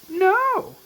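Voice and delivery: very high, loud cry of no; distant scream. Retold or imitated. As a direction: scream it from the background. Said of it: "you hear Daryl scream".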